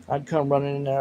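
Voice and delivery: ordinary talk, nothing marked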